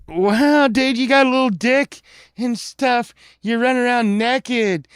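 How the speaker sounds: re-enacted boyish jeering; kids taunting. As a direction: taunt him like a gleeful boy. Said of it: "'cause we're like".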